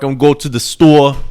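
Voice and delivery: hammed-up New Yorker saying "store"; New York accent. Shown as a demonstration. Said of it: "Not like New York".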